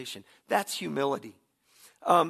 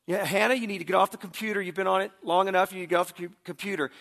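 raised-pitch call to another room; retold yell; 5 semitones higher, 5 dB louder, faster than usual. Father yelling to daughter from family room, half-strength retold yell. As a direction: imitate a parent calling out, a bit louder and higher. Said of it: "I used to yell".

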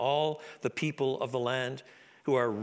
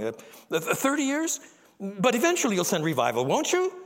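halting, rising pleas full of uh's; a nervous young man haggling for time; anxious Isaiah; 6 semitones higher than usual. Acted. Isaiah nervously haggling with God over how long.

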